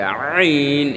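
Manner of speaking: আইন long drawn out, squeezed from the throat; pressed throat ayn. Shown as a demonstration. Teacher showing learners how Ayn is formed, pressed and drawn-out আইন. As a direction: press the throat hard and hold আইন slowly. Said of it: "আইনের উচ্চারণটা পেশার দিয়ে উচ্চারণ করতে হবে".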